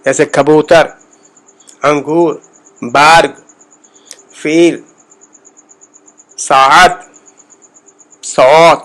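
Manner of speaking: ساعت with a rounded first vowel; isolated citation words, کبوتر to سوت, carefully sounded; Persian word list. Shown as a demonstration. Teacher reading shared words for learners, Persian word list. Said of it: "وہ میں پڑھتا ہوں".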